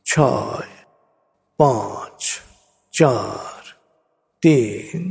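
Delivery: slow, soothing hypnotic countdown; guided meditation. Acted. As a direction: lead them down gently, step by step.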